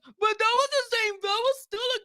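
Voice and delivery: high, loud mocking whine; hammed-up impression of defensive viewers. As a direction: parody the fans who argue back. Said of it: "And you guys".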